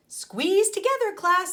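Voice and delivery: high, loud teacher's call; teacher summoning her class. Acted.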